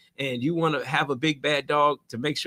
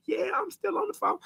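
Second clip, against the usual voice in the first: much higher, soft caller voice; mock caller reply; 14 semitones higher, 7 dB quieter than usual. Caller answering the landlord, much higher and soft. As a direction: chirp back that you're still there.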